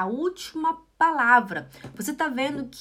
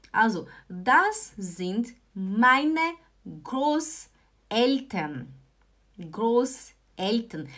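very slow model; much slower than usual. Shown as a demonstration. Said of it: "teacher drills slowly".

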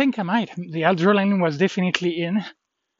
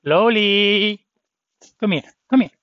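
calling the dog; 4 semitones higher, 5 dB louder than usual. Real, not acted.

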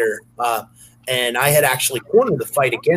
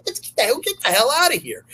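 high, urgent retold shout; urgent retold exclamation; 11 semitones higher, faster than usual. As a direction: pitch it up like you need to flee now. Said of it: "I said".

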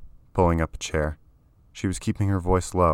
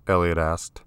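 usual speaking voice

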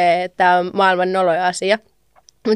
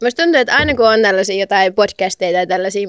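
normal talking voice

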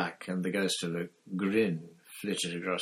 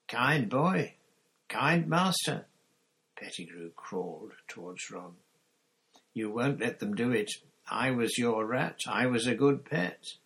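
grovelling Pettigrew; 5 semitones higher, 5 dB louder than usual. Acted.

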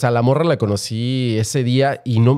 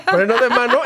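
laughing high through the words; 11 semitones higher than usual. Retold or imitated. Retelling finding the guy in bed, laughing. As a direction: giggle it out, amused at yourself. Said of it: "Sí, dije".

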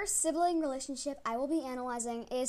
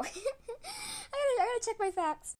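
laughing; 10 semitones higher than usual. Real, not acted. Laughing at own mistake.

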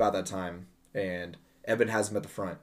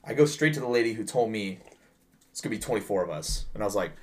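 normal talking voice

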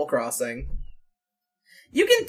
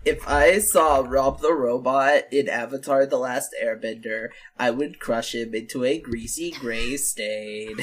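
low, deadpan YouTuber voice; Chills impression; 9 semitones lower, 4 dB quieter than usual. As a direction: drop low and deadpan menace. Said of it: "The fact that Chills has a video".

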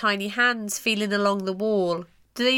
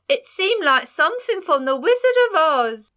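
higher-pitched, badly faked Pennsylvania accent; terrible Pennsylvania accent; 5 semitones higher than usual. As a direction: put on a hammy, unconvincing Pennsylvania accent. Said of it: "that's a terrible accent".

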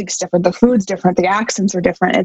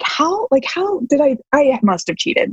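incredulous; 6 semitones higher than usual. Retold or imitated. Retold disbelief, high pitch.

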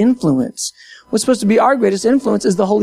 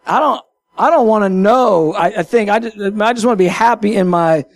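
ordinary talk

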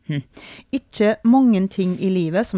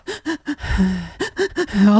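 hammed-up shuddering sob breaths; mock sobbing gasps. Mother re-enacts crying at farewell, mock sobbing. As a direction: act out gulping, tearful breaths. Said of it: "jeg griner og griner og griner".

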